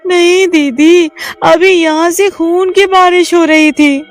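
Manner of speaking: scared and insistent; frightened insisting. Acted. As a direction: insist it really rained blood. Insisting it was blood.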